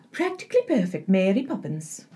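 put-on lightly lilting Scottish-tinged British accent; soft attempt at a British nanny, slipping Scottish; Mary Poppins. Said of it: "I gave her a Scottish lilt".

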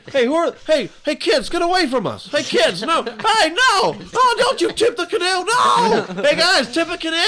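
high, loud panicked yelling; panicked canoeist. Acted. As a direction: scream at kids tipping the boat. Acting canoe couple ambushed.

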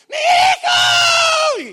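piercing, drawn-out high wail; Llorona-like scream. Retold or imitated. Mother sees her son has fallen in the well, imitated wailing scream. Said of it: "pega un grito que parecía la Llorona".

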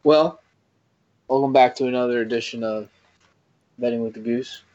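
show intro. Acted. Opens his segment like a show.

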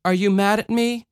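put-on small child, anxious question; worried child. A narrator's reading. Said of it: "the child asked".

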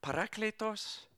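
high, hushed, puzzled questioning; baffled disciples. Retold or imitated. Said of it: "They just look at him like".

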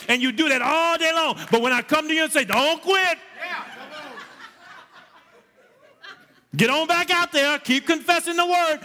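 high, loud fervent preaching. Acted. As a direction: drive it home to the congregation. Preaching at full force.

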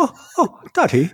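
very high mock child voice; hammed-up child; fake little kid. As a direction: squeal like a small boy, way up high. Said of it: "so fake".

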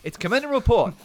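high, loud host call; segment announcer. Pretend. Host launches Commend and Report segment. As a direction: sell the segment title.

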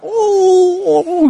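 high, drawn-out monkey "ooh"; monkey howl. Retold or imitated. Preacher mimicking a funny Facebook video, high monkey howl. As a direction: hoot it out long and high like a monkey. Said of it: "This monkey going".

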